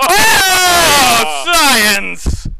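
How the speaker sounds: loud, high mad-scientist cry; evil scientist. Pretend. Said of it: "an evil laugh together as we count down".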